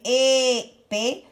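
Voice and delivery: "épée" with a long "é", then "pée"; syllable-by-syllable "épée"; split into syllables. Shown as a demonstration. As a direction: hold the "é", then place "pée". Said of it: "Je prends la deuxième syllabe".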